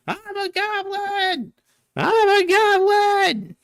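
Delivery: very high, slow, shrieking goblin cry; high squealing goblin scream. Acted. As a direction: shriek it like a frantic little goblin. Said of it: "a goblin screaming at one of the".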